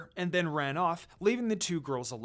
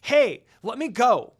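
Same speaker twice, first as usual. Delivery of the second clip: loud, slow struggling yell; 6 semitones higher, 10 dB louder than usual. A narrator's reading. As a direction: shout while fighting to break free. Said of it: "Kagome yelled".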